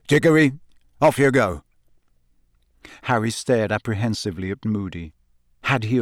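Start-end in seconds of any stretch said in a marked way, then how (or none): 0.1-1.6 gruff growled dismissal; growling. A narrator's reading. Moody sending Cedric off, growling. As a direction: growl him on his way. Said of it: "he growled".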